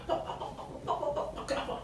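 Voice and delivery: soft whispered clip-clop hoof sounds. Shown as a demonstration. Storyteller imitates horses for the children, soft clip-clop. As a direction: tap out galloping horses with the tongue.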